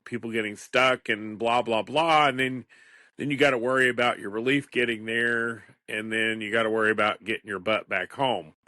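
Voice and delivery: ordinary talk, nothing marked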